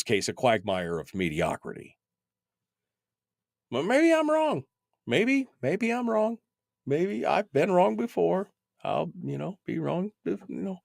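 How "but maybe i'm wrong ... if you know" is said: a mocking little voice that doesn't mean it; sarcastic mock doubt. Sarcastic self-doubt after rant.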